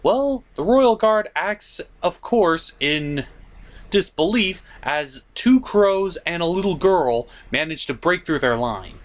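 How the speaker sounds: usual speaking voice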